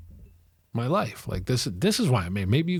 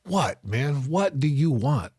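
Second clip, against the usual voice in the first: retold irritation; 6 semitones higher, 4 dB louder, slower than usual. Acts out irritated reply to neighbor.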